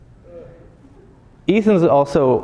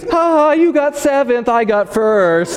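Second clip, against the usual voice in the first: put-on gloating kid taunt; 11 semitones higher, slower than usual. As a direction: gloat like the son who cheated. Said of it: "he goes".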